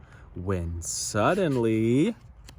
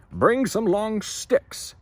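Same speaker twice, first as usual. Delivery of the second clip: higher, louder, slow order; shouted; 10 semitones higher, 5 dB louder, much slower than usual. Acted. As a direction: call out to the workers. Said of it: "shouted the harbor master".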